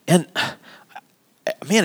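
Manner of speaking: an audible sigh before the word man; demo sigh. Shown as a demonstration. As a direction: let out a weary sigh. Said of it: "you can say".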